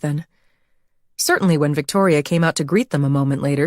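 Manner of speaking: plain reading voice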